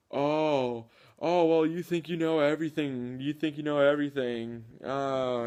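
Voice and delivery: mock indignant opponent. Mimicking the opponent accusing him of knowing everything, whiny. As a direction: sulk like a know-it-all accusation. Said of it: "And he goes".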